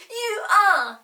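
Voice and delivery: high, loud whining accusation; whiny accusation. Acted. Peter accuses Molly, high whine. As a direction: whine it like a cross child. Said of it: "said Peter".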